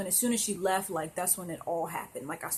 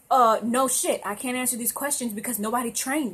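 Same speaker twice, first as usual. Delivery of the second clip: louder, exasperated outburst; 4 semitones higher, 7 dB louder than usual. Real, not acted. Venting about untrained retail work, exasperated outburst. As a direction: blurt it out fed up.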